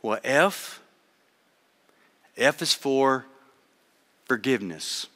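hushed, halting nervous reading; a timid first-timer stumbling through; quiet and hesitant, low and soft. Retold or imitated. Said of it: "She was really nervous".

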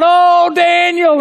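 high, slow, loud wail of the name; anguished scream. Acted. Frantic king calling down into the den, anguished scream. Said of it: "he begins to scream out".

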